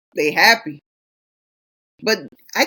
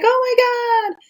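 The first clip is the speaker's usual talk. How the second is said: much higher, gushing thrill; mock delight; slower than usual. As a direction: squeal like a kid seeing snow. Joy at first snow.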